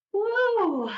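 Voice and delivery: high happy woo; joyful whoop. Pretend. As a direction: cheer the rescue. Escaping the building.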